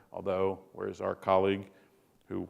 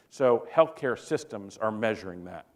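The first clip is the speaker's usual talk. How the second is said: normal talking voice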